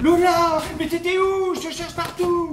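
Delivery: calling out. Acted. Acted scene, calling out.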